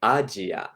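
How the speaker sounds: Asia as Japanese Ajia, ah-jee-ah; アジア. Shown as a demonstration. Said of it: "that will be".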